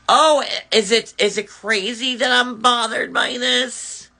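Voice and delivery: high, mock-innocent sarcasm; sarcastic. Real, not acted. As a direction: pretend to wonder if he's crazy. Sarcastic self-questioning.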